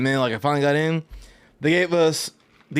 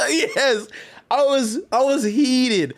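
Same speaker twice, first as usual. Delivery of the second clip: laughing; 7 semitones higher, much slower than usual. Real, not acted. Laughing at his own anger.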